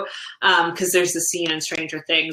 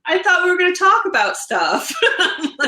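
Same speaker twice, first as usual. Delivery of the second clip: laughing; 12 semitones higher, 4 dB louder than usual. Retold or imitated. Quoting husband, laughing.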